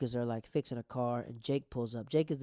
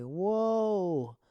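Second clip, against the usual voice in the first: drawn-out whoa, higher and louder; hyped reaction; 7 semitones higher, 6 dB louder than usual. Retold or imitated. Recapper reacts to the comeback in the show, hyped. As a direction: let out a long, loud whoa.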